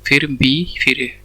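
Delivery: एम फिर बी फिर ए spelled out slowly, letter by letter. Shown as a demonstration. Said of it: "ऐसे बोल रहे हैं". (धीरे-धीरे अक्षर-अक्षर बोलना)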